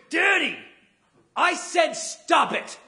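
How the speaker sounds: put-on high, loud, slow whiny kid with a laugh; angry daughter. Daughter angry at her dad in the car, high and whiny. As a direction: stretch it out like a fed-up little girl. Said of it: "my daughter gets angry at me".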